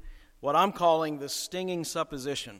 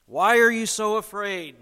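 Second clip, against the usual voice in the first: forceful question; 4 semitones higher, 8 dB louder than usual. Retold or imitated. Preacher repeating Jesus' question.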